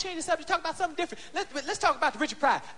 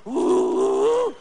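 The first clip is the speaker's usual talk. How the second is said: low moan; 9 semitones lower, 6 dB louder than usual. Retold or imitated. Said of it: "As soon as you go".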